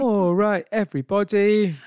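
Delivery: high, loud stage greeting; panto call. Acted. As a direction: hail the whole audience, hammed up. Answers with the panto catchphrase.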